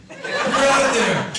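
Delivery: high, slow shooing yell; mock outrage. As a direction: chase them out of the room. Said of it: "clients don't like it".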